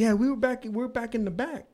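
high woman's voice, casual; older lady's voice. Retold or imitated. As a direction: imitate the lady explaining. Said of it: "She's like".

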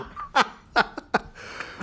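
high helpless laugh; burst of laughter. Real, not acted. Man laughing at his own story, helpless laugh. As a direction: break up laughing. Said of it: "his own laugh".

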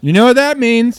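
hype announcer. Acted. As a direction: pump up the intro. Hype intro line.

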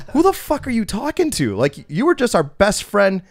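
high-pitched, incredulous confrontation; retold indignation. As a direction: act out an offended, baffled challenge. Group confronts the glass smasher.

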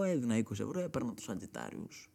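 normal talking voice

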